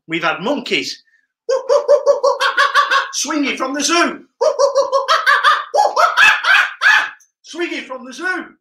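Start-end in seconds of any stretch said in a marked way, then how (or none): 1.5-3.1 real laugh. Real, not acted. Laughing at monkeys. 4.4-7.1 real laugh. Real, not acted. Laughing at monkeys.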